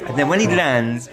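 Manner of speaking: ordinary talk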